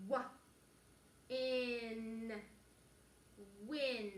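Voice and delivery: very slow stretched sounds, then blended; tractor reading. Shown as a demonstration. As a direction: push each sound slowly, then join them. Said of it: "the tractor is when you push the sounds like this".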